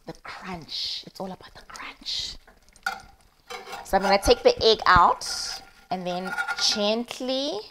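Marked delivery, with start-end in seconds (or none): 0.1-2.4 soft reverent whisper; hushed delight over the crunch. Real, not acted. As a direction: savour it like a foodie secret. Savouring burnt egg while cooking.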